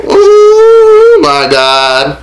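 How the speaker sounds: long drawn-out high "oh my God"; exasperated. Real, not acted. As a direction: stretch it way up in disbelief. Reacting to the cold food.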